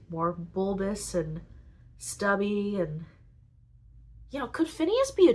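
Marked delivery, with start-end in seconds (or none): none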